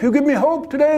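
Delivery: eager thanks. Acted. Kate thanking Edith warmly.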